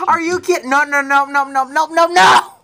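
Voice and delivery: high, loud wailing protest; despairing shout. Real, not acted. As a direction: cry out in disbelief at losing. Losing the race to a rival.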